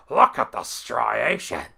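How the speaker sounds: loud, slow, gushing commentator mimicry; over-the-top admiring announcer; Vince McMahon impression. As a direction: marvel at it, stretched out and hammed up. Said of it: "he was doing a commentary".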